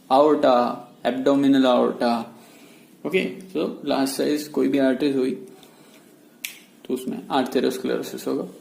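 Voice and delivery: ordinary delivery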